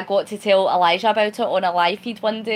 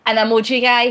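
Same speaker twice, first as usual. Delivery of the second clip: excited punchline; 8 dB louder than usual. Real, not acted. Punchline after "wait for it.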